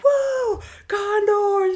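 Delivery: high excited whoop; hammed-up fan cheer. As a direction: greet him like a thrilled fan. Guy excitedly greets Condors fan.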